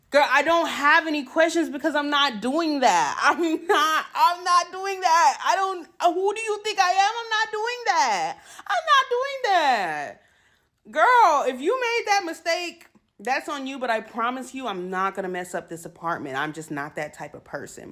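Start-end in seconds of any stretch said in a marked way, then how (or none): 0.1-10.2 indignant refusal. Real, not acted. Refusing the manager's deposit demand, escalating high indignant refusal. 10.9-13.8 high, loud sassy scold. Real, not acted. Scolding the property manager, high loud sass.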